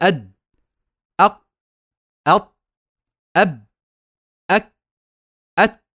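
"أد أق أط أب أك أت" each cut off abruptly; isolated short syllables, "أد" to "أت", shut hard; strong stop letters. Shown as a demonstration. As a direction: block the sound on each final consonant, pause between. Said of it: "حرف شديد".

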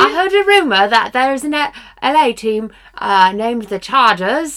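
hammed-up London accent with dropped r's; put-on British accent. Co-host sharing a Chargers rumor, put-on British accent.

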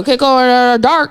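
teasing dopey mimicry; mock slow-witted. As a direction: lightly mimic someone dim to tease. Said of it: "He sounded like he was slow".

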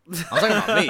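laughing through the line, high and loud. Real, not acted. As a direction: laugh it out at yourself. Laughing self-admission.

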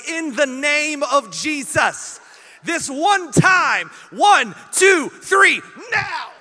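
loud, high, drawn-out prayer shout; full-force mock-preacher yell at an ATM; spiritual shouting. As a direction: shout it slowly like commanding a miracle. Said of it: "have you ever gotten spiritual".

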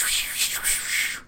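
breathy wind whoosh; soft whooshing wind noise; whispered whoosh. Retold or imitated. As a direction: blow it out like wind. Imitated wind sound.

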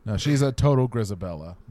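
ordinary delivery

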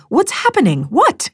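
high, alarmed shout. Acted. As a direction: call out urgently in alarm.

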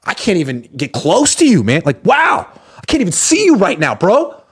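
high-pitched, fawning hype; hammed-up awestruck compliments; gushing flattery. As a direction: stroke their ego, voice way up high. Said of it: "he's, like, stroking people's egos".

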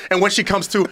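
ordinary delivery

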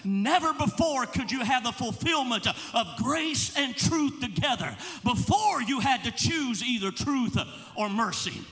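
fervent sermon climax; full-force preaching. Acted. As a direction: build the congregation up. Preaching over music, building.

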